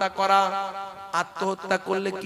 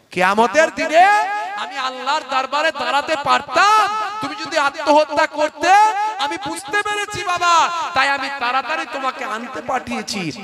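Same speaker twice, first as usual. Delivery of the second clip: full-force preaching; 7 semitones higher than usual. Acted. Preacher crying out to the young men, full force. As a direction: call out to the crowd like a preacher. Cleric's cry, preached loud.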